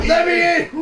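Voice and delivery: high, loud laughing cry to be let in; panicked yell. Retold or imitated. Officer pounding on the hatch, begging, in a loud laughing yell.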